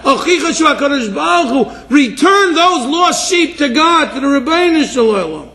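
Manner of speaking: high, ringing scriptural exhortation; impassioned preaching. Real, not acted. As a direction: plead with the congregation at full force. Preaches on the verse at full force.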